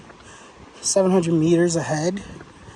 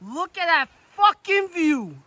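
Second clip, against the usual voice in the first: "Look at that fucking view" higher and slow, "fucking" leaned on; excited exclamation; 10 semitones higher, slower than usual. Real, not acted. Hiker calling out about the view to his friends, excited. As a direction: let the wonder burst out.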